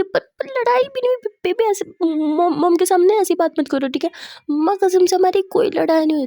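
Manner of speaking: high, stammering acted protest; flustered pleading. Wife fearing her partner will leave, flustered high pleading. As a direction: plead urgently, pitched right up. Pleads that there was no fight.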